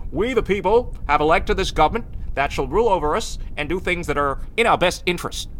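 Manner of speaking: lofty, booming president addressing the nation; presidential voice. Pretend. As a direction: deliver it like a grand presidential address. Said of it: "presidential voice".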